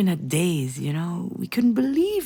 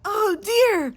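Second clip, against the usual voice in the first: put-on toddler exclaiming; 11 semitones higher than usual. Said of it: "You pointed in front of you".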